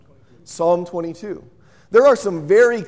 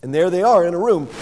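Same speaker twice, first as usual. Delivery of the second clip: normal talking voice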